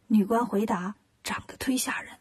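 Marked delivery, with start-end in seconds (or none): 1.2-2.1 breathy confiding whisper. Acted. Court lady whispering to the queen how ugly they are. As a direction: whisper it like gossip. Official warns queen about disciples.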